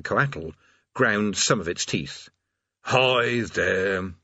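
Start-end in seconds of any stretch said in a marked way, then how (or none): 2.8-4.1 intoning demon. Acted. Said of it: "it intoned".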